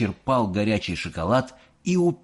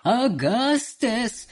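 high, slow drawn-out call; Wonka shouting; 13 semitones higher, much slower than usual. Acted. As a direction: call the boy in alarm. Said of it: "кричал мистер Уонка".

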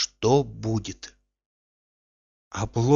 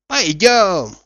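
high, louder call to go; hearty, raised-pitch suggestion; eager invitation; 8 semitones higher, 6 dB louder than usual. A narrator's reading. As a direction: invite a pal along with a lift in the voice. Coachman invites Zakhar along.

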